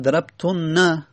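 ضربتن با نون مشدد و کشیده; با تشدید نون؛ ضربتن with the nun held long, drawn out slowly. Shown as a demonstration. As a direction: stretch the doubled nun in ضربتن. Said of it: "این نون تشدید داره".

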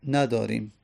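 normal talking voice

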